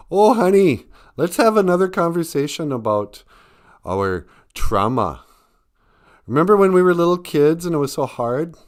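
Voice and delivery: high, loud mock-tender nostalgia; hammed-up sentimental old couple reminiscing; mock grandparent. Mock grandparents' conversation about trauma.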